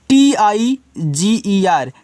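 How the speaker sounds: T I जी E R said letter by letter, slowly and clearly; slow spelling. Shown as a demonstration. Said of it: "तो इसको पढ़ना आपको tiger". (धीरे धीरे spelling)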